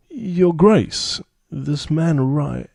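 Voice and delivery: high, loud urgent plea; pleading plea. Acted. Abbess addresses the ruler.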